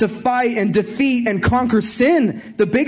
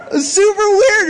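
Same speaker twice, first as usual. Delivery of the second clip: excited; 11 semitones higher, 5 dB louder, slower than usual. Real, not acted. Exclaims about the odd calling.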